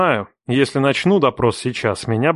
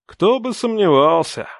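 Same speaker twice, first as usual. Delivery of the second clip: resigned, higher-pitched musing; philosophical sigh; 7 semitones higher than usual. A narrator's reading. Father sighs at Varya's choice of name, philosophically. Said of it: "Философски вздохнул я".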